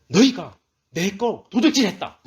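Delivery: loud, high accusation; imitating an angry divine rebuke; angry God. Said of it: "뭐라고 화를 내십니까?".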